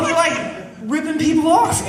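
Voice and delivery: high-pitched scandalised outburst; acted indignation. Storyteller exposing people ripped off, scandalised indignation. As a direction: push it up high in mock disbelief.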